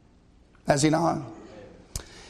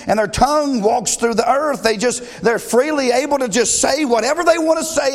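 high, loud preacher's cadence; fiery preaching; 7 semitones higher, 4 dB louder than usual. Acted. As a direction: preach it out to the congregation. Preaching at full force.

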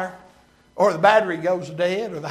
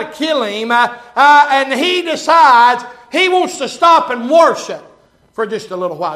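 loud, high, driving sermon voice; full-force preaching; 6 semitones higher, 7 dB louder than usual. Real, not acted. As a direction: preach it hard to the congregation.

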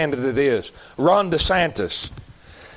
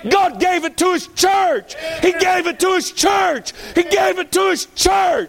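impassioned preaching shout; 11 semitones higher than usual. Real, not acted. Preacher declaring God gave power to His church, impassioned shout. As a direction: cry each phrase out like a rallying chant.

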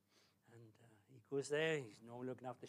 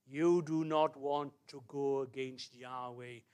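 slow, firm and loud; deliberate, emphatic preacher's caution; solemn warning; 8 dB louder, much slower than usual. Real, not acted. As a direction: weigh each word as a stern warning.

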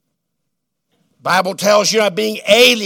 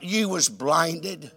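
slow hushed verdict; 5 semitones lower, 9 dB quieter, much slower than usual. Acted. Preacher answering quoted regret.

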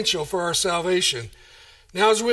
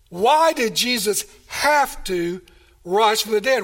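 usual speaking voice

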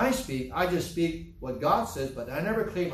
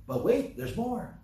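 infomercial pitch; 6 semitones higher than usual. Pretend. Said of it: "That part is just me".

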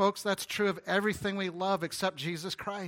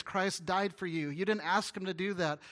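ordinary talk

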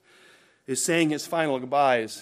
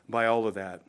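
normal talking voice

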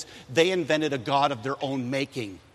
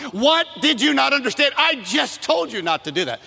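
loud, high scolding shout at her son, slowing as it builds; a furious mom blowing up at her kid; mother yelling; 9 semitones higher, 4 dB louder than usual. Retold or imitated. Mother scolding young Timothy, loud high angry shout. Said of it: "it was kind of that loud".

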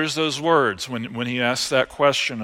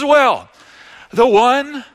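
higher, louder hesitant Pharisee; preacher acting out Simon's grudging concession; reluctant Simon; 8 semitones higher, 6 dB louder, slower than usual. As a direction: hedge it like a cornered Pharisee. Preacher voices Simon's reluctant answer.

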